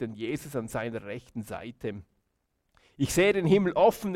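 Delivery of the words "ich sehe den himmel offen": raised, louder reading of a cry; calling out. A narrator's reading. Stephanus proclaims his vision of heaven, calling out. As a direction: call it out with a little lift. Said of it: "rief Stephanus".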